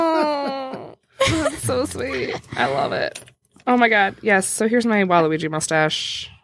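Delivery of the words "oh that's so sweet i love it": touched and delighted; soft delighted thanks; pleasantly surprised. Real, not acted. Receiving a handmade gift.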